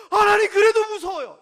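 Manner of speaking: high, loud whimpering plea; mock crying whine. Believer whimpers to God, mock tearful. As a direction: beg God tearfully, overdone.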